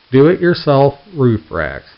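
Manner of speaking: slow and loud; slow emphatic punchline. Acted. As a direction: lay each word down slowly.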